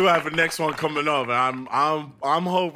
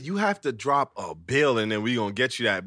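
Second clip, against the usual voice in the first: retold pitch; 9 semitones lower, 3 dB quieter than usual. Retells promoter's pitch to them.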